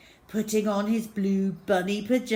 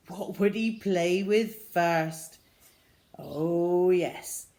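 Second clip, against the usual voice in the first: ordinary delivery, nothing marked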